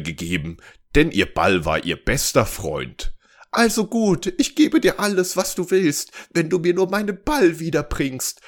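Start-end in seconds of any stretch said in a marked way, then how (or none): 3.5-8.4 high-pitched storybook princess; promising princess. A narrator's reading. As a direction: give in and promise, voice raised high. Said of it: "versprach die Königstochter". (versprechende Königstochter, hohe Stimme)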